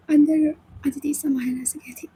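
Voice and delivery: ordinary delivery, nothing marked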